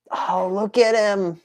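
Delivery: high, slow, doting pet-talk; cooing at kitty. Pretend. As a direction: coo slowly in a tiny high voice. Cooing over his cat figure.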